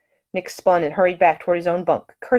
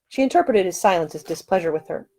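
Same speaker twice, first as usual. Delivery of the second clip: ordinary delivery, nothing marked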